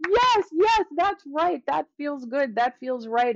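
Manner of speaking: high, gushing yes-yes voice; mock eager agreement. Mocking the public receiving these books, gushing and high. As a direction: parody people nodding along happily. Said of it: "a lot of people are like".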